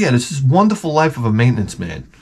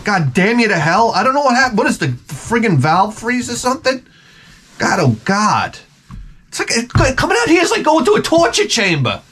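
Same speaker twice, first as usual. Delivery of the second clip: put-on a much higher, whiny old-guy voice, cursing at the mug; grumbling old janitor; 9 semitones higher than usual. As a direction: whine like a fed-up old janitor.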